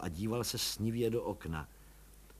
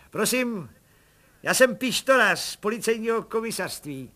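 much higher chummy busybody voice; cheeky little policeman; 10 semitones higher, 7 dB louder than usual. Acted. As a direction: play the eager nosy constable. Pištora voiced high.

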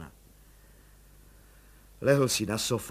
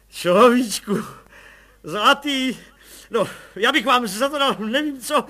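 much higher and louder, joyful calls; overjoyed shouting; 13 semitones higher, 10 dB louder than usual. A narrator's reading. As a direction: shout for joy to the wife. Overjoyed colonel calls his wife.

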